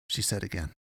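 usual speaking voice

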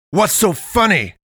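high, loud demanding outburst; indignant demand. A narrator's reading. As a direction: demand an answer at full voice. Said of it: "Tafari demanded".

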